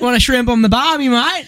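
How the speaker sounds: high-pitched mock Australian; squeaked-up cartoon Australian accent. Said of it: "in Australian doesn't make it sound good".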